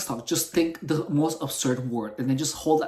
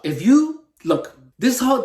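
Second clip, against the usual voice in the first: normal talking voice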